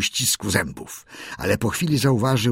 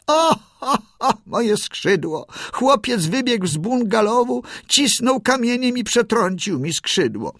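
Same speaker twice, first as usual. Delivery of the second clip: pitiful squeak; 14 semitones higher than usual. A narrator's reading. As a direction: fake a broken wing, squealing. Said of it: "zaczęła piszczeć żałośnie".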